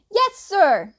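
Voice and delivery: loud, high class answering together; chorused shout. A narrator's reading. Whole class answers teacher in a loud chorus. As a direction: students shout back in unison. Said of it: "the class chorused".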